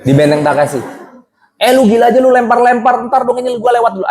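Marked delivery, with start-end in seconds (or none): 1.6-4.1 high-pitched imitation of an angry contestant; hammed-up high, indignant outburst; mock furious rant. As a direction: rail at the organisers in a high voice. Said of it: "yang ngamuk sama panitia".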